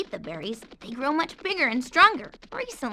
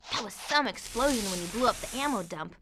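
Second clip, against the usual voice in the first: normal talking voice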